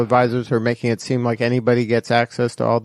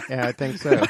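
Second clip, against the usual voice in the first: laughing. Real, not acted. Laughing along at the joke.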